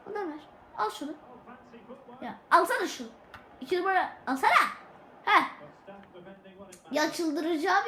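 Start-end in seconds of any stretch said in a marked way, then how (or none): none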